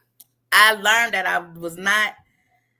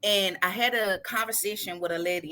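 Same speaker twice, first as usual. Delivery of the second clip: usual speaking voice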